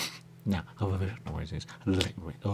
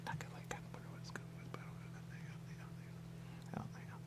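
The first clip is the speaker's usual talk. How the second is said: whisper; 18 dB quieter than usual. Real, not acted. Long unvoiced whispered run.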